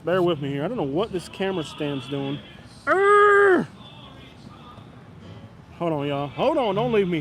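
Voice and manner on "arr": high, loud drawn-out "arr"; sudden yell. Real, not acted. Vlogger fumbling with new camera stand, sudden yell. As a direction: let out one long high cry. Startled or frustrated cry mid-setup.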